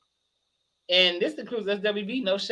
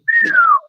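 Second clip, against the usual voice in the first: loud high cackle; 11 dB louder than usual. Real, not acted. Laughing at own story.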